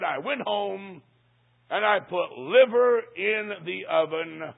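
drawn out slowly, weighing every word; slow, dramatic reveal. Retold or imitated. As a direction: drag it out like a terrible confession. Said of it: "I hate to even say it".

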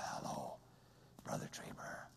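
voiceless, slow whisper through an electrolarynx. Retold or imitated. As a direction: breathe the words with no voice. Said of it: "His voice box has been removed".

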